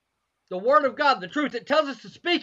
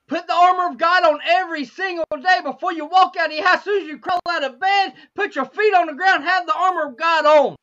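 raised, driving sermon delivery; emphatic preaching; 5 semitones higher, 4 dB louder than usual. Real, not acted. Preacher urging the church to arm themselves daily, emphatic preaching. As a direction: urge the congregation with conviction.

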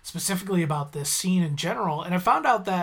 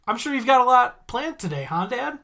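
teasing; 7 semitones higher, 4 dB louder than usual. Retold or imitated. Bart teasing Homer.

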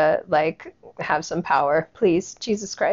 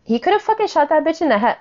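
high, fast exasperated rush; fast, raised indignant rant; 8 semitones higher, faster than usual. Real, not acted. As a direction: rattle it off in outrage.

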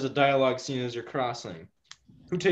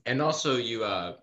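ordinary talk

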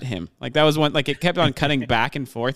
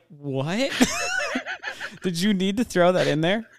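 mock disbelief; 6 semitones higher, 3 dB quieter than usual. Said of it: "And I was like".

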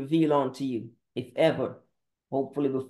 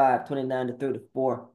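ordinary delivery

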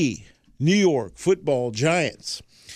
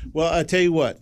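usual speaking voice

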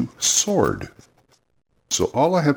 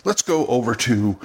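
normal talking voice

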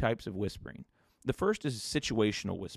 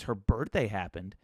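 ordinary talk, nothing marked

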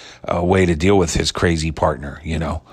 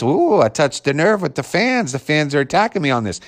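mocking Dave; 7 semitones higher than usual. Podcaster mimics host pleased he angered fans. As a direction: savour stirring up the fans. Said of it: "and when he knows it's".

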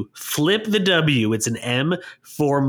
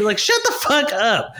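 exasperated; 11 semitones higher, slower than usual. Real, not acted. Exasperated outburst at fans.

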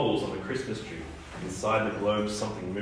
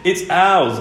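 higher, louder shouted claim; defiant shout; 8 semitones higher, 7 dB louder than usual. A narrator's reading. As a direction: shout it back at the giant island. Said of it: "Iris shouted back".

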